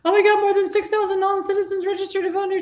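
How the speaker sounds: high, shrill-sounding put-on voice mocking the governor; mocking impression. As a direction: pitch way up and gasp it like a fake-shocked politician. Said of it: "So now she's coming out like".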